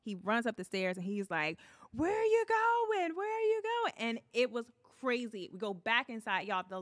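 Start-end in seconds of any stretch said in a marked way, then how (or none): 1.9-3.9 very high, loud calling; imitating incredulous pursuer. Imitating actor chasing her upstairs.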